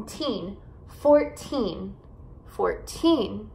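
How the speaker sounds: put-on FOURTEEN with a long stressed TEEN; four-TEEN, teacher's careful model of FOURTEEN; stress on final syllable. Shown as a demonstration. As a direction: lean on the end of FOURTEEN. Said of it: "enfatizar as sílabas no final".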